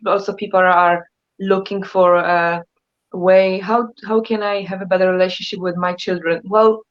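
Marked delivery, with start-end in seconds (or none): none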